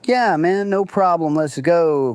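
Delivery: eager. Retold or imitated. Eager agreeing to go out back.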